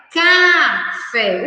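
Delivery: "Café" with a long, firm CA; "Café" split, first syllable stretched; showing the K sound. Shown as a demonstration. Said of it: "O K não tem som de S".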